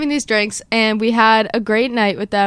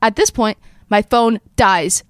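ordinary talk

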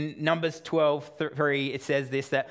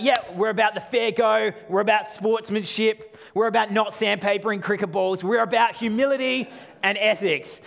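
higher, louder mock-proud Australian bragging; mock Aussie boast; 6 semitones higher, 5 dB louder than usual. Preacher mocking Australians claiming fair play, mock boastful. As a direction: puff up like a smug cricket fan. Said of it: "We're like".

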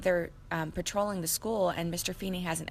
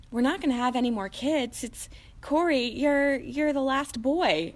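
tender parent; 8 semitones higher, 5 dB louder, slower than usual. Retold or imitated. Parents voiced telling son.

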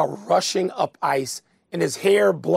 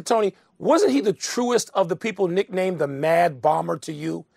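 usual speaking voice

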